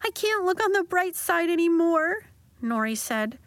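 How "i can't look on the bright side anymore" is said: high, crying voice; crying girl giving up on hope; tearful. Acted. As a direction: speak through the tears. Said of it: "Tears streamed down her cheeks".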